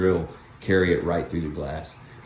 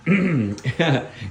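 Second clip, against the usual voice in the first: clearing a bug from the throat; hard throat clear after swallowing a bug; 7 semitones higher than usual. Real, not acted. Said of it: "think I swallowed a bug".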